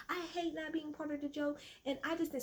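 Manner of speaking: high mock-childish complaint; whiny five-year-old. [name] complaining about being left out of the joke, five-year-old whine. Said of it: "She's literally acting like a five-year-old".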